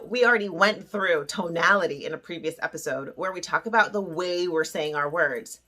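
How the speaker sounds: ordinary delivery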